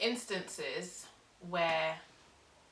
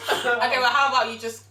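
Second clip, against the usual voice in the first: laughing retort; 7 semitones higher, 15 dB louder than usual. Real, not acted.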